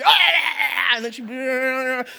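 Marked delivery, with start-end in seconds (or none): 0.0-0.9 loud yelp with a laugh in it; laughing mock dog yelp. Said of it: "pops her like".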